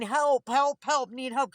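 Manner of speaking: loud, high, repeated mock cries; pleading for help. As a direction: imitate someone in withdrawal calling out. Voices a sufferer crying for help.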